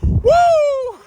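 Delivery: high, loud excited woo; child's woo. Retold or imitated. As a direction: squeal it like a thrilled little girl. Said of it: "she pointed at her mom and went".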